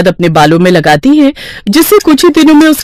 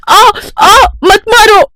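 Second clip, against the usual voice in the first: high cries of pain; high-pitched, panicked plea; pained pleading; 12 semitones higher, slower than usual. Acted. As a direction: yelp and beg for the blows to stop. Stepmother being beaten, cries out.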